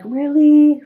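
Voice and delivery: high, loud mock disbelief; incredulous. Telling how she swore in church, incredulous high really. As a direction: squeak out a shocked "really". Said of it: "I'm like".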